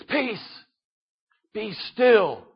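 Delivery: soft, quiet and settled; Jesus quietly calming the wind; calm command. Acted. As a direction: hush the storm gently but with authority. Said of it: "Jesus calmly".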